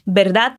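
"Verdad" with B sound, D almost mute; Spanish verdad. Shown as a demonstration. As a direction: say "Verdad" as "berdad", barely touching the D. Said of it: "O V tem som de B e o D no final é quase mudo".

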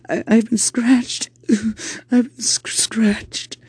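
put-on high, whimpering little boy; small child crying out hurt. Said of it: "Rob came into the room crying".

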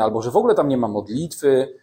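ordinary delivery

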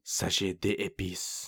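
careful slow French pronunciation; French: avec l'accent français; French accent. Real, not acted. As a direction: say it the French way, slowly. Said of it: "I'm sorry for my French accent".

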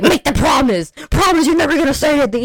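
high, loud, fast mock threat; furious shout. Barry choking Julie in front of Ray, furious shout. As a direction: shout the promise out of her, fast. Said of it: "he went up to Julie and choked her".

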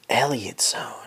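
breathy whisper of the title; whispered reveal. Retold or imitated. Recalling his shower idea, he whispers the title. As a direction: whisper it like a secret discovery.

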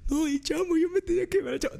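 high, whiny mock sobbing; mock crying. Drunk man crying over his lost friend, high mock wail. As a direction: lament it way up high. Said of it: "Yo llorando por el Chamo".

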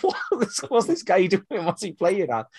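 high, incredulous "what's this guy doing?"; baffled disbelief. Retold or imitated. Recalling her own baffled reaction to the film, with high incredulity. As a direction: pitch jumps way up in mock disbelief.

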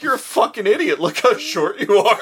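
half-laughing mock scorn; mocking crowd. Acts out everyone mocking Adams.

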